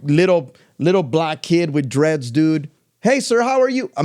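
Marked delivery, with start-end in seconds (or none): 3.0-3.9 high, bright, happy cashier hello; enthusiastic greeting. Retold or imitated. Imitating the cashier kid's enthusiastic hello. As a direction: beam at the customer. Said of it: "Enthusiasm, happy".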